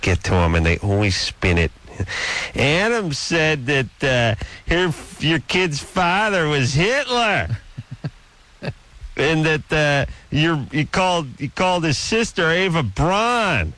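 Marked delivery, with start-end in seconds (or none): 2.5-13.7 high, drawn-out mocking whine; singsong mock outrage, pitched way up; mocking tattletale. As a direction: mimic a tattler, stretching the key words up high. Mimicking tattling relatives' complaints.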